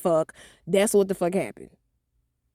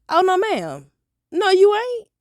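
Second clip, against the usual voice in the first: high sharp mom voice; mom challenging; 11 semitones higher, 5 dB louder than usual. Retold or imitated. Mom stopping her daughter going to bed, high and sharp. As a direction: raise it way up, a suspicious mother. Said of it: "she was like".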